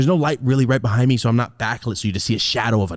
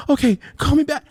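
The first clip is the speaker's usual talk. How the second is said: fake sobbing; 4 dB louder than usual. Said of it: "instantly right back to normal".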